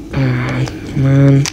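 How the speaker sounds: usual speaking voice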